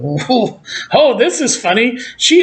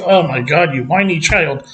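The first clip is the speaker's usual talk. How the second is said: lower, scolding Yoda impression; mock Yoda; 6 semitones lower than usual. Yoda scolding whiny Luke, a low mock voice. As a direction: talk down to him like a fed-up old master. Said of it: "And then Yoda goes".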